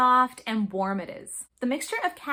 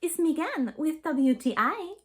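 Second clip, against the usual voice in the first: hammed-up French accent on a greeting; a deliberately bad French accent, played for fun; mock French accent, a light joke opener; terrible French accent; 4 semitones higher than usual. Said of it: "that was a terrible French accent".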